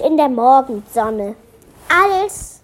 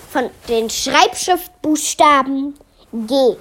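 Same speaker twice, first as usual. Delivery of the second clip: plain reading voice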